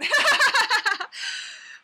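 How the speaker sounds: bright burst of high laughter; loud high laugh. Real, not acted. Vlogger laughing loudly at her own dancing. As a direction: let a big squealing laugh out.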